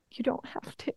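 hushed aside; whisper. Acted. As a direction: whisper it like a secret to the listener. Confiding aside after own story.

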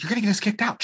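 high-pitched retold scolding; mock warning. Dad warning his son in the theater, urgent.

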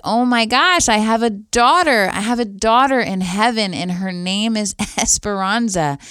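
joyful exclamation, easing toward the end. Retold or imitated. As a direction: relive the thrill of a wonderful discovery. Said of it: "I was filled with so much joy".

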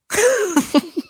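high-pitched burst of real laughter; cracked-up laugh. Real, not acted. Podcaster cracking up at her terrible impression, real high laugh. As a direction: crack up at your own impression. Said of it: "this cracked me up so much".

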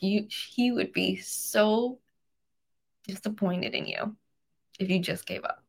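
haltingly, fighting back tears; soft, tearful ache, pausing between phrases; emotional. Real, not acted. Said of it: "I get emotional".

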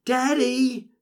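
high, drawn-out cry for a parent; child's yell. A narrator's reading. Ben to his father as grief takes over, high yell. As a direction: call out high as a grieving little boy. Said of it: "He yells".

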